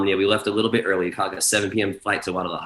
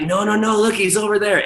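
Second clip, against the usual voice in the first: very high mock protest; pitched-up insistent correction; hurried reassurance; 12 semitones higher, 4 dB louder than usual. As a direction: imitate staff calming him with urgent high voice. Staff pointing out sleeping friend.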